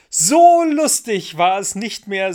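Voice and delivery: put-on very high, loud child's cry; little girl squealing with fun; delighted exclamation. A narrator's reading. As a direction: burst out gleefully, stretching "So". Ida exclaims from the flagpole.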